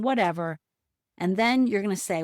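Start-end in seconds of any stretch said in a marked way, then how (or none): none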